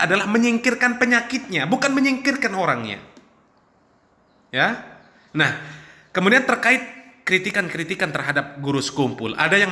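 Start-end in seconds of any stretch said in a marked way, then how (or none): none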